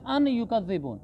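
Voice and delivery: "an yukazziboon", the n fully sounded; unmerged original. Shown as a demonstration. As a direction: clear n in अन before युकज़्ज़िबून. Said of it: "जैसे असल तो है".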